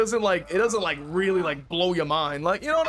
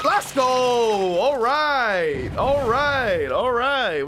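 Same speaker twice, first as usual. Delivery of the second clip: excited cheer; 5 semitones higher, 4 dB louder, much slower than usual. Real, not acted. Hyped after a good play.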